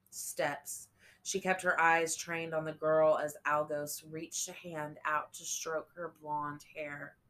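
usual speaking voice

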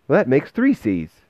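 put-on high, loud child voice; bright-eyed boy piping up; young boy's voice. A narrator's reading. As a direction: pitch it well up like a small boy. Boy's voice repeating the line.

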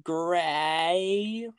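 long drawn-out American "grey"; American accent. Shown as a demonstration. Speaker showing how they said it as a kid, exaggerated American "grey". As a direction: stretch "grey" slowly in a put-on American accent. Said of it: "my horrible American accent".

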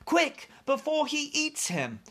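put-on very high, panicky girl's voice; urgent cry. A narrator's reading. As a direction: plead urgently in a high little voice. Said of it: "she cried".

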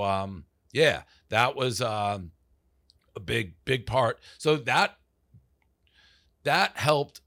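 ordinary delivery

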